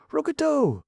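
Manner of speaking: high, loud shriek of fury; shrill shriek. A narrator's reading. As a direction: shriek the name as if it burns. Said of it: "shrilled Calico".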